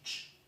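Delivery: "ch" whispered with no voice; voiceless ch sound. Shown as a demonstration. Teacher showing the voiceless ch sound to students. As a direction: say a breathy voiceless ch. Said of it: "una ch".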